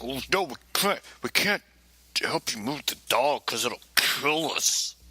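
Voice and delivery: mocking the officer's voice; silly cop voice. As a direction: drag out the cop's question in a goofy voice. Cops refuse to help.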